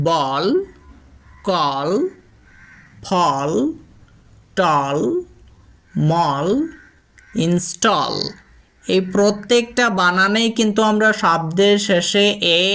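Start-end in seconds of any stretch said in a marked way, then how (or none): 0.1-8.1 বল, কল, ফল said with a round aw vowel; example words said carefully. Shown as a demonstration. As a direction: model each word slowly. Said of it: "যেমন". (অ ধ্বনিতে স্পষ্ট উচ্চারণ)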